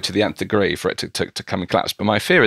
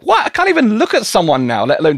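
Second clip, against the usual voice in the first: much higher and louder, exasperated; outraged disbelief; 12 semitones higher, 5 dB louder than usual. Retold or imitated. Guest ranting about not being allowed to look at people, high loud exasperation. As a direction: throw up your hands, voice jumping way up in loud mock outrage. Retelling reaction to tube poster.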